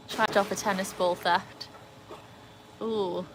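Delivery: ordinary delivery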